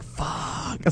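under-breath whisper; whispered swear in dismay; whispered curse. Retold or imitated. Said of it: "I was like".

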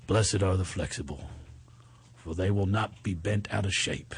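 put-on Philly accent. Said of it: "in my Philly accent".

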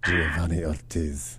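low, slow, soft savouring of a name; mock seductive. Host relishing caller's friend's name, mock seductive. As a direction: purr the name like a late-night lothario.

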